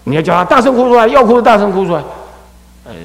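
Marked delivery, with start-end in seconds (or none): 0.4-2.0 high, loud, fast order; raised insistent call; loud command. Shown as a demonstration. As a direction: order a child to let it out. Demonstrates ordering child to cry aloud.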